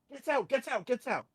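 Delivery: high, rapid-fire order; urgent shooing. Real, not acted. Parent to son nearly walking in, urgent shooing. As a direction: shoo someone out in a hurry.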